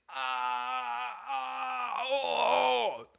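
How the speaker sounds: high cries of stomach pain; pained high moans. Retold or imitated. Rocking in pain.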